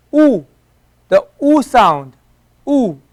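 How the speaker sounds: long oo sound, stretched; a slow, rounded oo; u vowel. Shown as a demonstration. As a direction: model the oo like boots. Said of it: "like boots".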